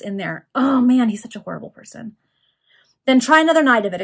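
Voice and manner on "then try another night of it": raised, louder villain's outburst; exclaiming. Acted. Villain to his locked-up victim, exclaiming. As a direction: throw it out higher and louder, lightly acted. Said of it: "exclaimed the Resurrection Man".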